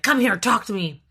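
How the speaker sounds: a touch of a blunt, demanding summons; bossy demand. Shown as a demonstration. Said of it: "delivery also helps. Instead of, like".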